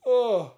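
loud yawn sneaking out mid-sentence; real yawn. Real, not acted. Host yawning before reading out questions. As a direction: let a yawn slip out loud. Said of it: "that was a yawn".

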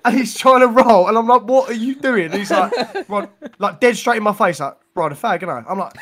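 loud, laughing storytelling; bursting with laughter while telling the tale; excited retelling. Real, not acted. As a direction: tell it loud, cracking up. Loud laughing retelling of drunk mate.

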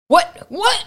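loud, high, confused waking what; startled what. Retold or imitated. Half-asleep husband sitting up suddenly, startled loud what. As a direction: jolt awake and demand what. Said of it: "you're like".

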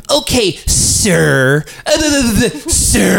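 slow, high, drawn-out mockery; sarcastic mock sir. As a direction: throw the sir back at him. Caller mocking the sir.

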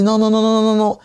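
non répétés, bien plus aigus et forts; mère qui refuse. Retold or imitated. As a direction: imite sa mère qui coupe court. (la mère refuse net, voix haute et forte)

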